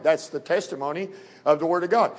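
usual speaking voice

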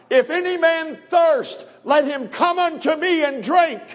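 loud, high call to the multitude; crying out. Acted. Preacher voicing Jesus crying to the temple crowd, high and loud. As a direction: lift your voice and proclaim it. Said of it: "he lifted up his voice and he cried".

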